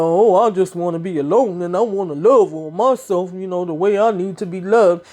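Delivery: lightly voiced dreamy woman, mocking her; mocking Tracy. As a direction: play her self-love speech with mild scorn. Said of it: "all that mess she talked about".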